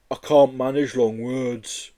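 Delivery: a mournful monster correcting him; sad Yeti. Acted. Yeti at the window to Joe, slow and sad.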